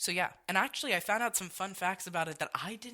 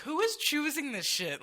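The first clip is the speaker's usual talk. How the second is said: exasperated outburst; 9 semitones higher, 5 dB louder than usual. Retold or imitated. Acts reaction to bad music.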